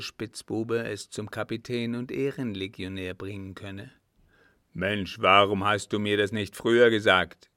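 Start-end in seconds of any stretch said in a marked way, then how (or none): 4.7-7.5 put-on loud, agitated old captain; shouted demand; exclaiming. A narrator's reading. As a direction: call it out as a heated reproach. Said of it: "rief er".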